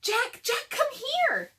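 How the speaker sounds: put-on high, loud eager girl's call; Annie calling. A narrator's reading. Little sister calling brother to her discovery, high eager shout. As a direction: call him over, excited.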